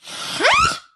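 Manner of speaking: sudden piercing high shriek; terrible witch shriek. Acted. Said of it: "let out a terrible cry like".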